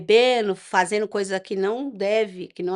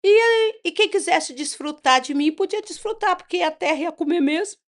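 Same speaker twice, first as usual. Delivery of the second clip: higher, worked-up retelling of bravado; retold defiant declaration; 8 semitones higher, 4 dB louder than usual. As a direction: retell it with raised, reckless defiance. Retelling her own defiant declaration.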